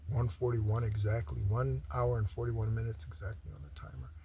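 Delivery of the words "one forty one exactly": ordinary talk, nothing marked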